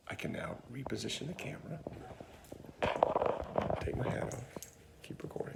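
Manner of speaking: whispered aside behind the camera; hushed unvoiced whisper. Real, not acted. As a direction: whisper stage directions so the take stays clean. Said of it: "unvoiced whisper aside".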